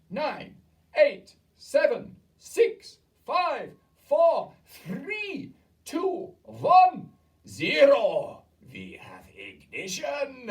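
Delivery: high, loud, slow countdown rising to a shriek; gleeful countdown. A narrator's reading. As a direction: count down like a launch. Witch counting down to zero.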